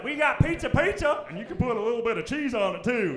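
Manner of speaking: high, eager mock server voice; mock small-town server. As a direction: play the clueless small-town cook. Small-town locals offering bland food.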